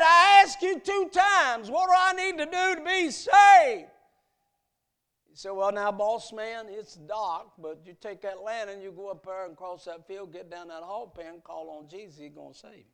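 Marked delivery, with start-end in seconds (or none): 0.0-3.9 higher, louder desperate plea; the boss man pounding on Sam's door, shouting his plea. Acted. 5.5-12.8 put-on gentle, unhurried old servant giving directions; Sam the servant. Servant Sam voiced again.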